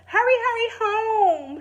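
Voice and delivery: put-on high, loud little boy voice; little brother calling. Little brother calls to Tilly leaving for school, high and loud. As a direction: call after her, small and pleading. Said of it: "her brother called".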